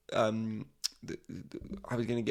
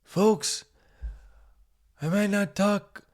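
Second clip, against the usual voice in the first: high-pitched put-on American politician voice; high, halting presidential impression; Joe Biden; 8 semitones higher, much slower than usual. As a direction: address the crowd as an ageing president, high and halting. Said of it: "even worse was Joe Biden coming out".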